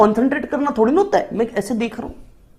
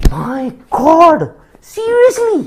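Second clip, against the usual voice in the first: high, amazed "seriously" with a put-on girlish voice; mock girl's gasp; 6 semitones higher, 4 dB louder, much slower than usual. Date hearing about nine aunts, mock amazed and high. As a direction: gush like a shocked girl. Girl reacts to big family.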